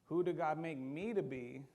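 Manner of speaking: slow, searching questions; searching self-question. Retold or imitated. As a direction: ask the mirror slowly, seeking. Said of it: "look at yourself in the mirror and go".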